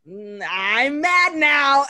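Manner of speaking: slow, loud mock rage; Hulk. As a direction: drag it out, swelling into a roar. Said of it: "it's almost like the Hulk".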